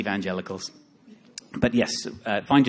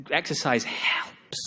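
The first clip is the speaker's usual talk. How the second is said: slow, strained whisper; grudging admission; 3 semitones higher, much slower than usual. Acted. Admitting to the audience what he hates saying, grudging whisper. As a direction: squeeze it out slowly, half whispered. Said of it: "I hate saying it out loud".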